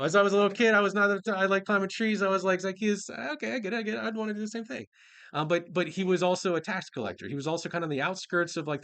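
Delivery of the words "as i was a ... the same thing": high, amused, excited rush of confession; playful, high-pitched enthusiasm; giddy joking. Real, not acted. Joking about himself as tree-climbing kid.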